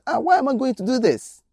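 high, fast, disbelieving cry; startled protest. Acted. Hazael protests high and fast that he would never do this. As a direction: recoil at the accusation.

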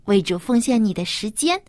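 ordinary delivery

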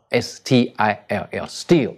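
S T I L L spelled letter by letter, slowly, then steal. Shown as a demonstration. Teacher spelling out an English word for listeners, slowly. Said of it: "有一个字叫做steal".

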